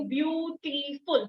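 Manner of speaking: Beautiful split into view, टी, फुल; beautiful with each syllable held apart; three separate syllables. Shown as a demonstration. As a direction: sound out beautiful in three slow beats. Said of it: "तीन pauses लग रहे हैं ना".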